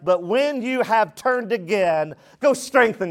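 high, measured preaching voice; scripture proclamation. Real, not acted. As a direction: proclaim the warning, drawing out phrases.

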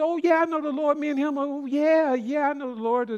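homeless man's breezy reply. Retold or imitated. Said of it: "I said".

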